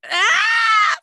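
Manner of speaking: a high, loud shout of laughter; a real burst of laughing shriek. Real, not acted. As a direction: scream with delight at the joke. Reacting to the punchline.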